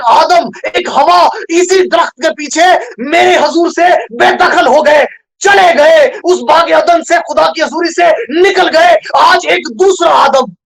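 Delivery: high, slow, shouted sermon cry; full-force preaching. Acted. Preacher tells of Jesus seeing Adam's loss, full-force preaching. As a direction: proclaim it at a shout, drawing out the phrases.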